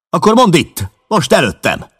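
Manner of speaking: defiant shout at the rival. Acted. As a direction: yell it right in his face. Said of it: "kiáltott rá McMurdo".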